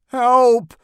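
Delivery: high, loud cry for help; panicked, high-pitched shout. A narrator's reading. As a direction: yell out for rescue. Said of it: "shouted Neil".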